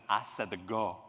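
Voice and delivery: lightly voiced French starter, low and soft; a hint of a baffled Julia Child; murmured, puzzled French starter; confused Julia Child. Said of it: "held up the stopwatch and said".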